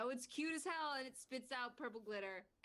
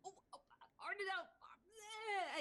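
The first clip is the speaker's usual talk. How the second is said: slow, broken, higher flustered stammer; speechless stammer; 10 semitones higher, slower than usual. Acted. Saya too flustered to speak to Arnett, stammering. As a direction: gasp the words out, too flustered to talk. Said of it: "she can't even speak".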